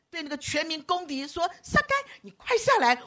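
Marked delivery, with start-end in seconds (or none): 1.6-3.0 high call to someone up a tree. Pretend. Jesus beckons the public enemy down, calling high. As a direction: call him down, bright and eager.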